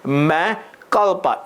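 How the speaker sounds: मैं कल्पा, first word said as मैं, not मियाँ; मैं कल्पा spoken as Hindi मैं, slow and clear. Shown as a demonstration. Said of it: "इसको मियाँ नहीं पढ़ना, मैं पढ़ना".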